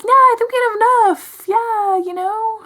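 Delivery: high, loud, forced pleasantness; awkward polite refusal. Retold or imitated. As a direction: beg off in an over-polite high voice. Retelling her awkward refusal of pictures.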